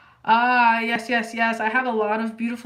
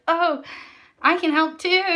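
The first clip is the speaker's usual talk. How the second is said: high, loud, bright offer; lightly voiced eager helper; eager donor voice; 7 semitones higher, 7 dB louder than usual. Imitating a donor's reaction.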